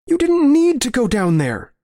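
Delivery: high, distraught protest; anguished reproach. Acted. Narrator reproaching his violent friend, anguished and high. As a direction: cry it out high, falling away at the end. Quarrel over the killings.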